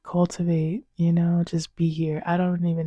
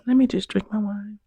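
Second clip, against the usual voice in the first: ordinary talk, nothing marked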